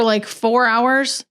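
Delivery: ordinary delivery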